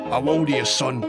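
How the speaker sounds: sceptical elder sizing up a lad; old sergeant. Acted. Sergeant questions boy.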